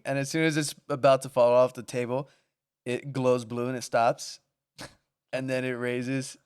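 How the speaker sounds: usual speaking voice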